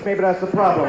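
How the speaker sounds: ordinary talk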